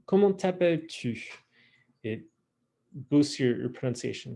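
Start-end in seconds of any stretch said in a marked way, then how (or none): 0.1-1.2 comment t'appelles-tu with lips pushed out on the last word. Shown as a demonstration. Teacher demonstrates for students, lips forward on tu. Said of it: "if you really bring your lips out for that last word".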